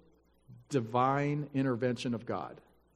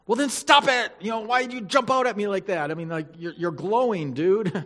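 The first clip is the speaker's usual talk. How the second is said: much higher, louder complaining voice; annoyed Zechariah; 6 semitones higher, 5 dB louder than usual. Pretend. Zechariah griping at the glowing angel, higher and louder. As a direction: whine back at the angel who startled you.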